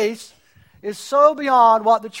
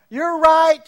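ordinary talk

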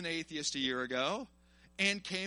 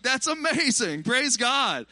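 high, loud, drawn-out praise; thrilled reaction, re-enacted loud and high; retold delight; 6 semitones higher, 7 dB louder, slower than usual. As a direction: celebrate the good news, stretching it out. Retold delight at friend's conversion.

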